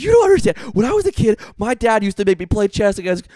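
high, loud frantic mock rant; freaking out. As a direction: imitate someone spiralling into panic. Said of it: "She starts freaking out".

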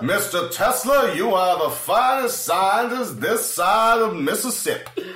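high, slow, grand Southern drawl; Southern gentleman Mark Twain. Retold or imitated. Host voicing Mark Twain to Tesla, slow grand Southern voice. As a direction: declare it like a proud old Southern gentleman.

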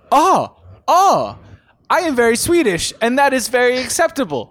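high, loud, bright-eyed cheer; cheery Hovland impression. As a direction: beam it out like an eager young pro. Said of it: "I don't know if that was a Swedish accent".

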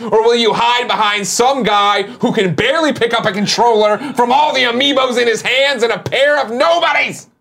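slow, loud and high wrestling shout; wrestling promo voice. Acted. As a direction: challenge your rival like a WWE heel. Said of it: "that wrestling voice".

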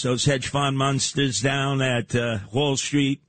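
ordinary delivery

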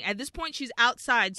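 ordinary talk, nothing marked

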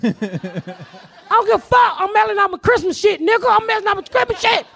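laughing hammed-up defiance; retold defiance. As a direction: yell back at the fireman. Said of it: "I'm like".

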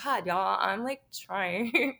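slow, teary, put-upon whine; mock whimper. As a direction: plead like you're about to cry, half joking. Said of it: "And I'm like".

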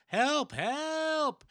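high, shrill-free falsetto cry for help; high falsetto help cries; damsel crying for help. Acted. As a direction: squeal help like a captured woman.

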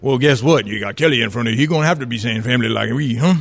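rolling, folksy Louisiana talk; Louisiana accent. Acted. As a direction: ham up a Southern Louisiana local. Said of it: "you're talking like this".

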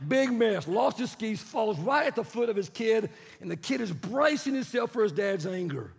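loud, high, excited narration; animated preacher storytelling. A narrator's reading. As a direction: tell the disaster at full force.

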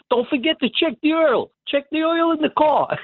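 high, hammed-up old Brooklyn Italian voice; jokey old-neighborhood Brooklyn accent; Italian-New York accent. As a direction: toss it off in a mock Mulberry Street accent. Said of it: "if you hear me do an accent now and then".

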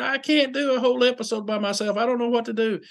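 high, mock panicked partner; scared. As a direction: imitate a frightened co-host pleading. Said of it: "she was scared to death".

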